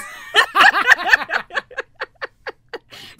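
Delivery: high, loud burst of laughter. Real, not acted. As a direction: crack up hard.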